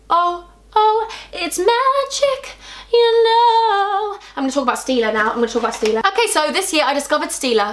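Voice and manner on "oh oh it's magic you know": mock magic voice. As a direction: croon it like a stage magician. Silly exclamation about magic.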